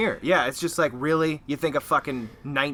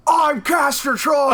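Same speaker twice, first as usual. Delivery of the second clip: much higher, slow, shouted movie line; mock Castor Troy yell; 12 semitones higher, 6 dB louder, much slower than usual. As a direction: bellow it like a hammy action villain. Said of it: "[name] does the".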